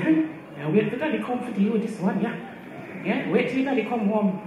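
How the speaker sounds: a mother's wait-till-your-father; mock warning mum.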